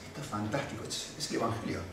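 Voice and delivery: dropped to an almost whispered hush; a quiet, breathy aside of wonder; hushed awe. Real, not acted. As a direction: marvel softly at the gospel. Awe after forgiveness reading.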